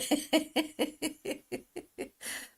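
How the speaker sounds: high giggling laugh. Real, not acted. Amused by a viewer comment.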